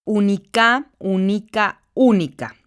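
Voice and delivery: Única repeated with stress on Ú; stressing the tonic syllable. Shown as a demonstration. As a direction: lean on the Ú of única, ending in a fall. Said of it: "la sílaba tónica se encuentra en la antepenúltima".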